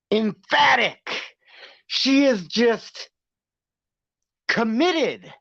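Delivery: high, slow mock-earnest praise; sarcastic emphasis. Streamer mocks Jordan Geiger's confidentiality claim, drawn-out sarcastic emphasis. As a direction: stretch each word with exaggerated conviction.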